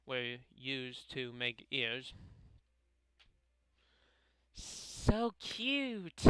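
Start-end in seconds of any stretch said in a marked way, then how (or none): 4.5-6.2 high, drawn-out character gush; gushing squeal. Acted. Club girl seeing cat cupcakes, high adoring squeal. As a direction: coo over it in a pitched-up voice.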